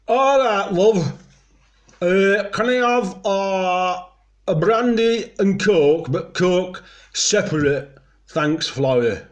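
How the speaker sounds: loud, high, loose-jowled Yorkshire pub voice; hammed-up Yorkshire drinker ordering; Yorkshire accent. Shown as a demonstration. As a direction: put on broad Yorkshire, jowls slack, calling over the bar. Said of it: "the important thing about speaking Yorkshire".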